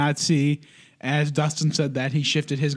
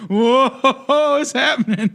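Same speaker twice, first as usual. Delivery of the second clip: high, loud, drawn-out mock bewilderment; dazed stoned stroke victim; 10 semitones higher, 5 dB louder, slower than usual. Co-host imagining being high mid-stroke, mock bewilderment. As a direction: play someone too high to follow, stretched and laughing. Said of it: "So you're probably high as fuck".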